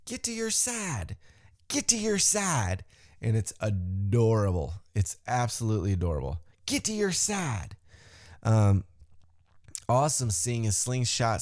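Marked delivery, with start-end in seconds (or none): 0.0-2.8 mock Southern Kentucky twang, higher; playful Kentucky yell; Kentucky accent yell. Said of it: "her yelling ... thick Southern Kentucky accent". 6.7-7.8 mock Southern Kentucky twang, higher; playful Kentucky yell; Kentucky accent yell. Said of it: "thick Southern Kentucky accent".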